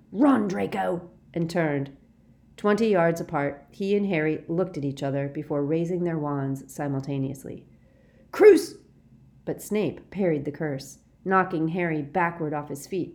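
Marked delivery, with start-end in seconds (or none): 0.1-1.1 slow, raised command; urgent shout. A narrator's reading. Snape ordering Draco to flee, shouted. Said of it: "Snape shouted". 8.3-8.7 sharp high shout, cut short; yelled curse. A narrator's reading. Harry cursing Snape, yelled. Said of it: "yelled Harry for the second time".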